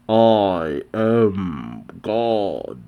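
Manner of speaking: slow, drawn-out divine proclamation; imposing god voice. Acted. Demon god floating before the congregation, slow and imposing. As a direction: declare it like a god looming over them.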